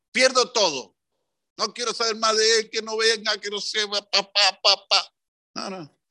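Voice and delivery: high, sulky mock outburst; stubborn huff. Offended man cuts off his friend, mock sulky huff. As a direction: act out the petulant grudge.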